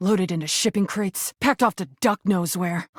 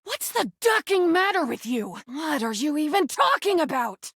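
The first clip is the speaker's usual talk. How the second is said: high, heated snapping at someone; angry outburst; 8 semitones higher than usual. Acted. Rubber duck lashing out at the player, angry pitched-up outburst. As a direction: lash out, defensive and stung.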